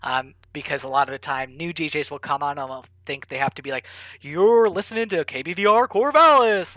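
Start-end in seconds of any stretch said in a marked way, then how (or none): 4.2-6.8 higher, louder announcer voice; overdone radio host announcing the station; mock radio DJ voice. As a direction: ham up a cheesy radio DJ. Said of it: "they have to sound really like a radio reporter".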